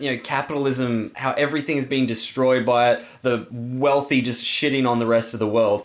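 ordinary delivery

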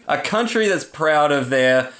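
ordinary delivery

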